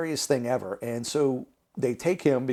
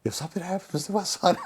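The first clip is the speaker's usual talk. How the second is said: unhinged; 7 semitones higher than usual. Retold or imitated. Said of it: "I was unhinged".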